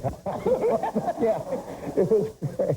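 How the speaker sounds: high, drawn-out mock Bush chuckle; a hammed-up pleased Bush; imitating Bush. Comic telling Bush's reaction, imitating him. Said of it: "Bush is like".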